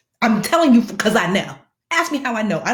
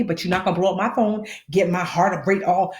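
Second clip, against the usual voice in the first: ordinary talk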